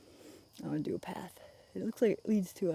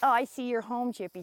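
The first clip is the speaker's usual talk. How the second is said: normal talking voice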